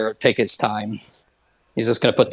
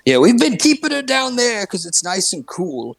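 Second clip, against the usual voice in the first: breezy host; 9 semitones higher than usual. Acted. Mort chirps about the body.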